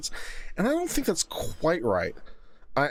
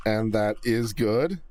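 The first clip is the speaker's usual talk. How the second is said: slow, drawn-out poll option; slow deliberate reading; 3 semitones lower, slower than usual. Pretend. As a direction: read it out slowly like a ballot. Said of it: "reading poll options".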